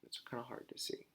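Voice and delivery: fast whispered aside; teacher muttering aside to class. Real, not acted. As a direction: breathe it out quickly as an aside.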